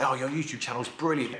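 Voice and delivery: ordinary talk, nothing marked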